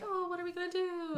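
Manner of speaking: high, soft, fretful mock voice; mock dramatic worry. Mocking the movie couple, high and fretful. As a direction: squeak it out like a fretting heroine. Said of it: "and they're like".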